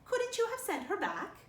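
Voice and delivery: a nosy, pointed question; Rachel Lynde's voice. A narrator's reading.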